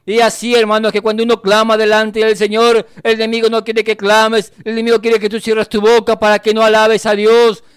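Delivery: sustained, insistent preaching delivery; fervent preaching. Real, not acted. Preacher exhorting the brethren to keep crying out, fervent. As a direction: preach it urgently to the congregation.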